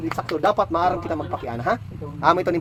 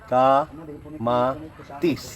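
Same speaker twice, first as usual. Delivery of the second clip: slow drawn-out repeat; 7 semitones lower, 3 dB quieter, much slower than usual. Shown as a demonstration. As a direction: stretch every syllable of Kamatis very slowly. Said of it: "Una, palithin". (mabagal na pagbigkas ng Kamatis)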